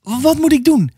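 high-pitched desperate plea; desperate cry. A narrator's reading. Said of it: "riep ze wanhopig". (wanhopige uitroep, hoge stem)

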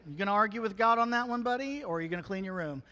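loud, fast, teasing dare; mock challenge to kid. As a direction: call the kid's bluff, fast and loud. Parent challenging complaining kid.

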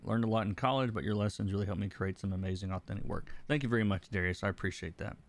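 normal talking voice

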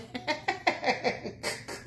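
silent, airy wheeze of a laugh; breathless laugh. Real, not acted. Laughing at her housing story, airy wheeze of a laugh. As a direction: laugh almost without voice.

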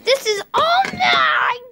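slow, high, crying shout of ownership; greedy shout. Acted. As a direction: wail it like a spoiled brat. Playful claim on the house.

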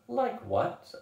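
high-pitched, nervous question; fearful. A narrator's reading. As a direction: ask dreading the answer. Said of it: "I asked fearfully".

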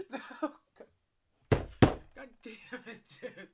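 high, squeezed cursing through real laughter; laughing exasperation. Real, not acted. Actor failing to regain composure, laughing exasperation. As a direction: protest while still cracking up.

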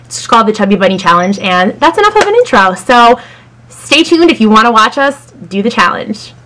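ordinary delivery

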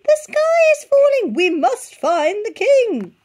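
very high, loud squawk, slowing on the king; squawking chick. A narrator's reading. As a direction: shriek it like a panicked chick.

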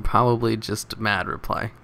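usual speaking voice

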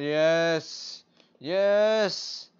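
loud, high yes of victory; triumphant cheer. Real, not acted. Finally finding the card, a loud triumphant cheer. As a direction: cheer like you just won.

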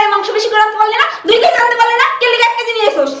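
high, loud, fast mock whine; mocking the mother-in-law. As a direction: sneer her demands back. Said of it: "তাহলে বলে". (শাশুড়ির কথা ব্যঙ্গ করে উঁচু গলায় নকল)

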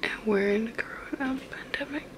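soft unvoiced whisper, hushed. Real, not acted. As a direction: whisper so nobody in the house hears. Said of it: "'cause I have to whisper".

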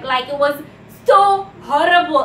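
ordinary talk

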